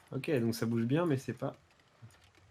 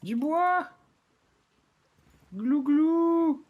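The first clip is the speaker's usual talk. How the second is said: loud, very high gobbling shout; gobbling turkey voice; 8 dB louder, much slower than usual. Pretend. Wood chant again.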